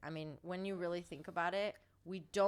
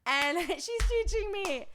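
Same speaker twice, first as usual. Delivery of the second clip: laughing with pride; 10 semitones higher, 8 dB louder than usual. Real, not acted. Proud laugh after nailing the word.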